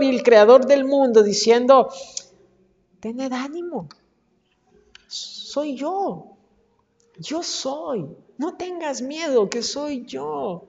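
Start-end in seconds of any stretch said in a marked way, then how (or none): 3.0-10.6 soft, gentle voice, much quieter; gently soothing frightened disciples; tender reassurance. Acted. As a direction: speak it like a hushed loving promise. Jesus' words of peace, voiced.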